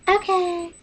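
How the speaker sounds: high, bright sign-off; cheery goodbye. Real, not acted. Vlogger signing off to viewers, high and cheery. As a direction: chirp a quick high farewell.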